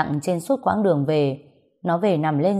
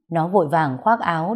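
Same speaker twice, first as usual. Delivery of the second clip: ordinary delivery, nothing marked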